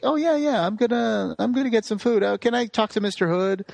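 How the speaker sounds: high, mock-innocent acted eagerness; feigned casualness. Boy pretends to want food, feigned casual eagerness. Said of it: "he pretends to be like".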